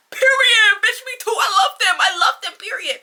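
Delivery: high squealing cry of delight; squealing fangirl. Retold or imitated. Agreeing with the character about the band, squealing fan cry. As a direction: scream it like a superfan.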